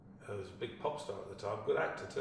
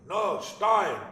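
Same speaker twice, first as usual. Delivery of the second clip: higher, louder "No, Stein" bellowed; impatient shout; 10 semitones higher, 11 dB louder than usual. Retold or imitated. Director shouting the word at Wagner. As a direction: bark the correction across the room.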